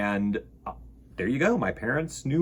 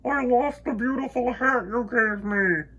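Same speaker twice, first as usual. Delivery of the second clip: echoing moon; 9 semitones higher than usual. Acted. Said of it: "said the moon".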